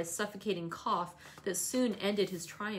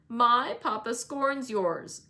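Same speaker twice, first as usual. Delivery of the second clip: loud, slow, scornful boast; indignant child's loud retort; crying out; 3 semitones higher, 7 dB louder, much slower than usual. A narrator's reading. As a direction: throw it out as a drawn-out taunt. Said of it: "cried Linton".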